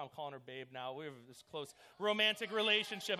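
normal talking voice

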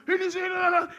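high, loud worked-up sputter; imitated outburst. Caretaker pulled off child by mom, worked up. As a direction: act out a frantic, angry protest.

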